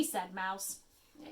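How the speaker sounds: plain reading voice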